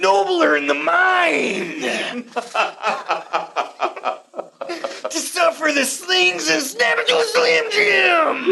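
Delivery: slow, shouted, laughing Shakespeare; hammy wrestler Shakespeare. Acted. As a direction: boom out Hamlet like a wrestling promo.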